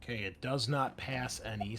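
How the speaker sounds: ordinary delivery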